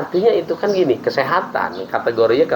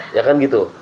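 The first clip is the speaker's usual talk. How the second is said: normal talking voice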